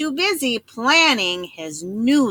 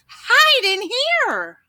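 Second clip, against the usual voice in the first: high, slow, loud call to hide; squeaky urgent call; urgent cry; 13 semitones higher, 4 dB louder, much slower than usual. Acted. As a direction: pig calling brothers to safety. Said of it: "cried the third little pig".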